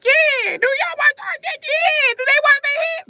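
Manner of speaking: very high, loud squealing woman's voice; imitating a woman. Nosy woman asks about handwashing, shrill mock squeal. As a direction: screech it like a nosy lady. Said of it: "The bitch is like".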